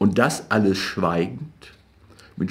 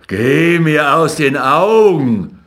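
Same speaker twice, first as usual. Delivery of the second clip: louder, slow, drawn-out roar; howling; 4 semitones higher, 6 dB louder, slower than usual. A narrator's reading. Jakob lunging at Rothschild with fists, howling. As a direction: howl it as he lunges. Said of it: "heulte Jakob".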